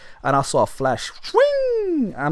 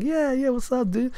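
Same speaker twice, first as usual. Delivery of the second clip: higher, falsely breezy; teen glancing back, fake-casual and higher; 11 semitones higher, 4 dB quieter than usual. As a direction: play it innocent and light. Faking casual to the man.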